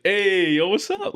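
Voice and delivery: loud, high, bouncy hey yo; upbeat greeting. Retold or imitated. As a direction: pitch the greeting way up with energy. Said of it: "normally I'd be like".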